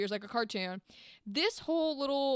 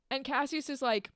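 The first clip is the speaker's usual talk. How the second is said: ordinary delivery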